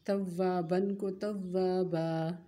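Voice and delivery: तव्वाबन becomes तव्वाबा, drawn out slowly with a long final aa; pausal form. Shown as a demonstration. Teacher demonstrates stopping on two zabar. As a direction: say तव्वाबा slowly, stretching the ending. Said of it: "उसमें हम कैसे वक़फ़ करते हैं?".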